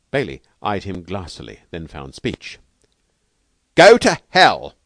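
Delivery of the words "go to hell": loud, high, drawn-out shout; furious shout. A narrator's reading. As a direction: explode at the kindly offer. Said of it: "he shouted".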